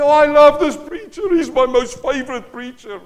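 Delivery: imitating an adoring fan, high and loud; gushing churchgoer. Pastor mimicking a member who adores the preacher, in a mock gushing voice. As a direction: ham up a congregant raving about the preacher.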